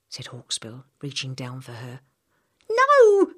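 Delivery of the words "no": high, loud desperate scream. Acted. Girl refusing the hospital, screaming. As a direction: scream it with everything. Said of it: "she screamed".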